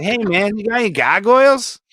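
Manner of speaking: high, put-on Boston voice asking for gargoyles; mock the guy at the expo table; Boston accent, hammed-up; imitated Boston customer. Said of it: "He went to my table, was like".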